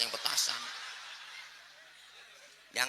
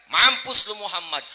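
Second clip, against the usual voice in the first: high, loud taunting outburst; loud curse; 10 semitones higher, 13 dB louder than usual. Acted. Unbeliever cursing the Prophet, loud taunt. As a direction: hurl it out at full voice.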